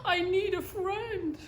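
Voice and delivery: high, slow plaintive plea; lonely little beaver. Acted. Little Beaver to the voice across the pond, slow plaintive plea. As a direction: draw it out forlornly. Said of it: "he said".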